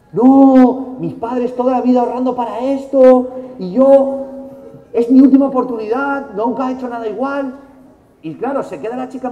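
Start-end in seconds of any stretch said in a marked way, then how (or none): 0.1-7.5 loud, high, desperate plea; desperate pleading shout. Retold or imitated. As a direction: beg her loudly as if your whole future depends on it. Desperate plea to the admissions girl. (súplica desesperada a gritos, aguda)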